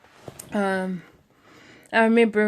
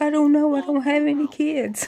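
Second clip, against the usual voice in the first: retold freak-out; 7 semitones higher than usual. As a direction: replay your own helpless panic, voice raised. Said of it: "like, I was freaking out".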